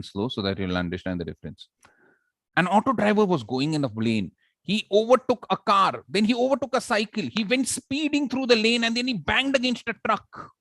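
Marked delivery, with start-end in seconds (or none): 2.6-10.5 rising, excited storytelling push; fast action. Shown as a demonstration. Teacher demoing fast speech for the auto driver chase. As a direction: race through the crash like action. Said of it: "I went fast because that was action".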